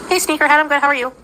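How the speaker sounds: chirpy greeting voice; upbeat readout. Acted. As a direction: read it like a cheery hello. Reading a chat message aloud.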